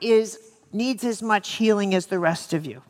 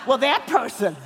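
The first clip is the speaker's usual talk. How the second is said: laughing retort; 3 semitones higher than usual. Retold or imitated. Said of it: "And I'll say".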